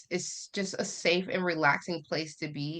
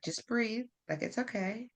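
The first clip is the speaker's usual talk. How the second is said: soft, hushed, a touch higher reassurance; husband soothing; 4 semitones higher, 8 dB quieter than usual. Retold or imitated. Husband on the phone calming his panicking wife, soft and reassuring. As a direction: gently talk her down. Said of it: "he was like".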